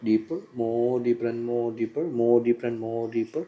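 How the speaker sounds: hypnotist's slow, droning countdown into trance; hypnotic induction. Real, not acted. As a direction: draw out each repetition, lulling them down.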